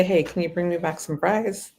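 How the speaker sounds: ordinary delivery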